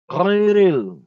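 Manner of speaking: Khairil loud, with a rough throaty kh; correct pronunciation. Shown as a demonstration. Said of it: "Khair ini seperti G dia".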